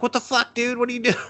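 high, mock-outraged DJ girl; mock angry DJ. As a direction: play the DJ shocked on air. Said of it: "she's just like".

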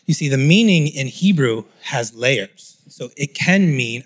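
normal talking voice

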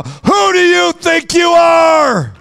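high, slow, shouted challenge. Shown as a demonstration. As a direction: bellow it at the enemy. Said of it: "Somebody needs to shout it right now".

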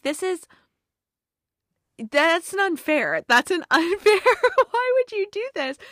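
high-pitched wail of protest, voice cracking into a laugh; mock outrage. Retelling her reaction to the tearjerker ending, a high indignant wail. Overwhelmed by the sad movie ending.

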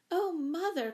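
put-on high, excited child's cry. A narrator's reading. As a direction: cry out eagerly to mother. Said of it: "cried Jane".

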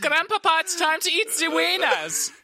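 high, loud cartoon German grandchild; hammed-up German accent, calling out. Host mocking Germans to show offence, high German accent.

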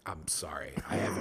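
breathy whisper, laughing through it; whispered mock apology. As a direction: whisper it like an embarrassed coworker. Imitating the woman's apology.

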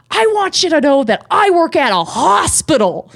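high, swooping mock-outraged announcement; retold screaming. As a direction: imitate an indignant customer proclaiming to the room. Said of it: "And she screams this out".

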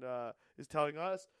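ordinary talk

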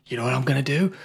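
hushed whisper. Retold or imitated. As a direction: whisper it like a secret plan. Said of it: "Ryan Reynolds is like".

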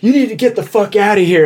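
high-pitched heated order; retold fired-up anger. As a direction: imitate someone fired up, throwing a person out. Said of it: "getting all fired up".

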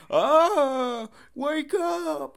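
very high, loud drawn-out yell; mock screaming. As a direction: yell it like a frantic man. Acting out a man yelling in mud.

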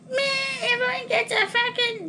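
high, whiny mock cop voice complaining; mock campus cop. Mocking the campus cops, high and whining. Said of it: "campus cop bullshit idiots would be like".

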